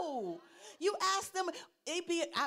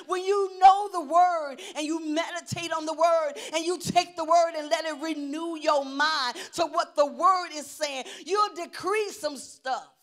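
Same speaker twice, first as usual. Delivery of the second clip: loud, driving, raised preacher's voice; full-force preaching; 3 semitones higher, 10 dB louder than usual. Real, not acted. Preacher urging church to know the word, full force. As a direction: drive the point home at full volume.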